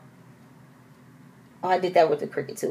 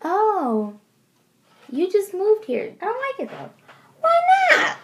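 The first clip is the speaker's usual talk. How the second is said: mimicking a neighbor; 14 semitones higher than usual. Imitating a local's comments.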